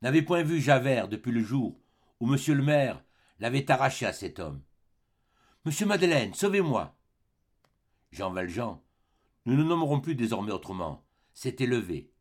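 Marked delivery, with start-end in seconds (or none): 5.6-7.0 frightened plea. Acted. Fantine begs mayor for rescue.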